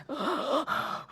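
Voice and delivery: panicked gasp. Retold or imitated. Watching in panic.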